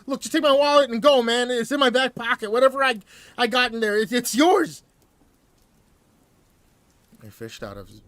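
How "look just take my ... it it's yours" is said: high, loud, fast panicked stammer; tripping over words in fear; stammering terror. Acted. Terrified hostage stammering at the robber, high and frantic. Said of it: "My hostage stammered".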